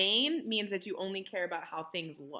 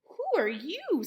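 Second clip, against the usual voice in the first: high, drawn-out wondering; wide-eyed, high-pitched Cinderella; surprised question; 10 semitones higher, 4 dB louder than usual. A narrator's reading. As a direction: ask slowly in a raised, astonished voice. Cinderella startled by the stranger.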